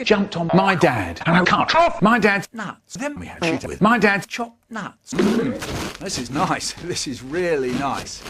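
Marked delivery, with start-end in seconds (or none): 5.1-8.2 hushed and content; soft savoring. Acted. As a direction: savor it quietly. Quiet savoring repeat.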